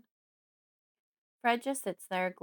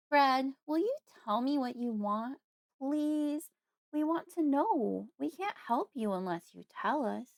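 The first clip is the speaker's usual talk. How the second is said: put-on sweet, innocent baby talk; 6 semitones higher than usual. A narrator's reading. Daughter coaxing Fred to say what he wants, sweet and innocent. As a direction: coax sweetly, as if talking to a baby. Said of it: "make my voice sound as sweet and innocent as possible".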